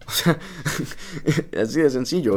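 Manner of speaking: hablar riéndose, con tono más agudo; entre risas. Real, not acted. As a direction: let the laugh spill into the words. Se ríe de su propio ejemplo. (entre risas, voz aguda)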